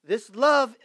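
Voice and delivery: loud, high, drawn-out preacher's cry; full-force preaching. Acted. Pastor crying out to his congregation, loud and high. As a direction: preach it to the rafters.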